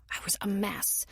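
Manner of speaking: barely voiced, sad whisper. A narrator's reading. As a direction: drop to a whisper. Recalling trauma, whispered.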